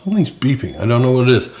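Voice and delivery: ordinary delivery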